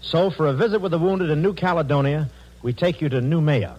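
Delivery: formal network announcer read; booming wartime radio introduction. Real, not acted. Network announcer hands over.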